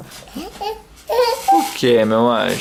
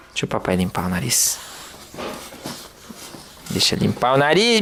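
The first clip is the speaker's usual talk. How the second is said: ordinary delivery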